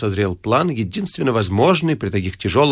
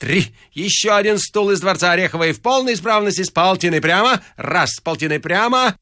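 higher, carrying auction call; creaky auctioneer; 7 semitones higher than usual. A narrator's reading. Auctioneer selling one more palace chair, creaky calling voice. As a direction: call the bids out across the room. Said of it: "раздавался скрипучий голос".

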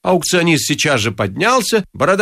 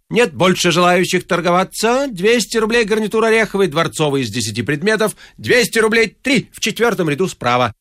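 high, carrying auction patter; auctioneer's closing call; 6 semitones higher than usual. A narrator's reading. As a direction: call the final bid to the room.

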